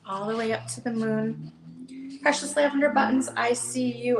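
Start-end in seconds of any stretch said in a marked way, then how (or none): 0.0-1.5 gentle storybook read-aloud; reading to children. Acted. Seller reads the hare book to viewers, storytelling voice. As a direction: read it like bedtime for a little one.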